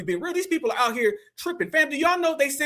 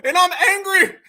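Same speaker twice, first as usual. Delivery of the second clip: slow, loud, higher mock outrage; mocking, drawn-out angry yell; mock angry old man; 6 semitones higher, 5 dB louder than usual. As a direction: imitate an upset old man's protest. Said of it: "He's very upset and very mad and very scared".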